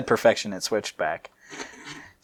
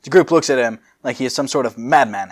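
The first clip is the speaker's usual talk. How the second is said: normal talking voice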